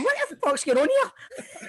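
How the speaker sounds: high, slow, laughing disbelief. Retold or imitated. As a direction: laugh through the question. Imagined baffled teammate.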